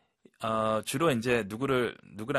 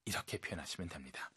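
ordinary talk, nothing marked

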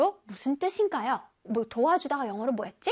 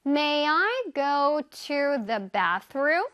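May I go to the bathroom? 을 한 단어씩 아주 천천히; slow model sentence; much slower than usual. Shown as a demonstration. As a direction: read it slowly for learners. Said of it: "영어로 한번 표현해 볼게요". (아주 천천히 또박또박 읽는 예문)

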